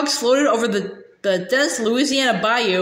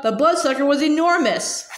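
ordinary delivery

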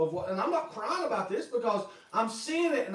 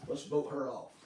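hushed, conspiratorial, much softer; scheming aside; 7 semitones lower, 7 dB quieter than usual. Pretend. Pastor as Survivor player, hushed scheming aside. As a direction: plot quietly behind her back. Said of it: "I would say".

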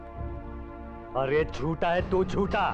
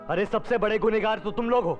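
raised, high-pitched condemnation; angry accusation; 6 semitones higher than usual. Acted. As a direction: hurl the blame at him. Accuses the man of guilt.

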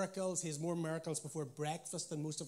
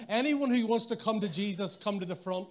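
put-on interpreter calling out an altar call in a Northern Irish accent, louder; mock Northern Irish interpreter, a touch raised; 4 semitones higher, 8 dB louder than usual. Interpreter relays the altar call to the crowd: Northern Irish accent. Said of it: "let's pretend the interpreter had a Northern Irish accent".

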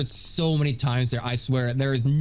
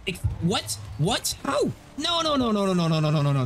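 frustrated disbelief; 6 semitones higher than usual. Real, not acted. Boss kills him, disbelief.

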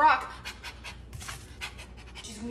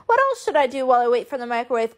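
ordinary delivery

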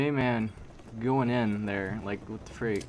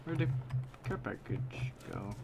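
whisper; 7 dB quieter than usual. Real, not acted. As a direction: whisper it to yourself. Looking for care package.

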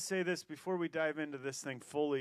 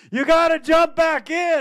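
yelled much higher and louder; shouted call; 11 semitones higher, 16 dB louder than usual. Retold or imitated. Friends on the bank shouting to him, urgent yell. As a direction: call out over roaring water.

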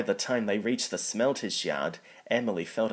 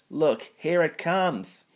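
slow, high, scared warning; terrified warning; 7 semitones higher, 4 dB louder, slower than usual. A narrator's reading. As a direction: point and cry in fear. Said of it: "cried Thomas".

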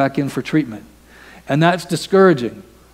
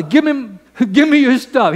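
higher, laughing mock demand; pitched-up greedy son, half laughing; 10 semitones higher, 3 dB louder than usual. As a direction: play the spoiled son grabbing.

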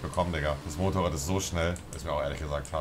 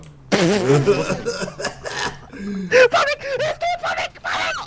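howling, shrieking laugh, much higher and louder; loud burst of laughter; 10 dB louder than usual. Real, not acted. Laughing wildly at his buddy's insult in the game. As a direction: crack up completely.

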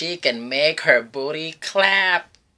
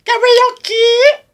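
much higher, much louder, slow; big hype shout; 10 dB louder than usual. Acted. Host cheering on a nervous singer, slow booming shout. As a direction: belt it out to the room.

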